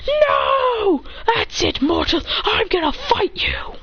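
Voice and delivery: high crying shout calming to a threat; angry outburst. Acted. Champa challenging the mortal, angry high shout. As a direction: explode at the mortal. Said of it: "Then Champa says".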